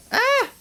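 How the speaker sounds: sudden high-pitched yelp; short, high startled "Ah!"; startled scream. Real, not acted. Player reacting to the blue one, startled scream.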